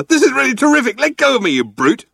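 high, indignant loud protest; spluttering. A narrator's reading. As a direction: struggle and splutter in outrage. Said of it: "spluttered Arthur".

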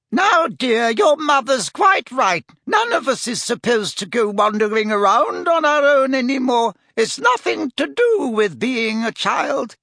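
high-pitched fussy shop owner; Madam Malkin. A narrator's reading. Shop owner soothing a young customer, high and fussy. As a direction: chide gently in a high lady's voice.

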